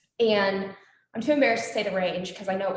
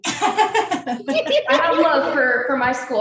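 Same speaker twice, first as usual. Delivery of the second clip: words stretched through a real laugh, higher and louder; 6 semitones higher, 8 dB louder, much slower than usual. Real, not acted. Grad laughing to the host about loving her school. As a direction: laugh your way through it, a little embarrassed. Laughs at host's warm remark.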